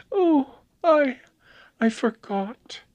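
put-on very high, faltering girlish voice; hushed distress. A narrator's reading. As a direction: trail away in high, pained hush. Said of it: "she whispered".

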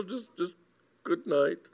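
high, faltering farewell; nervous backing down. A narrator's reading. Cyril too craven to ask for his book, high and faltering. Cyril losing nerve before explorer.

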